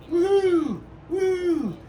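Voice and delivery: high, gleeful whooping; excited whoop. Real, not acted. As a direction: whoop like you're cheering yourself on. Cheering while salting chicken.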